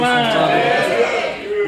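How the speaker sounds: high, loud and very slow; loud, stretched-out cry of amazement; awed exclamation. Real, not acted. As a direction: proclaim the miracle slowly, in wonder. Miracle testimony climax before congregation.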